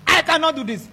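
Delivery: loud, high, defiant shout; proud shouted refusal. Retold or imitated. Preacher mimicking a proud person, loud and high. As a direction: throw it back at them, shouting. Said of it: "You think I'm like you?".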